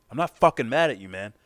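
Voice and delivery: loud, high exasperated snap; acted blow-up, denying the anger; mad outburst. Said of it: "He's mad".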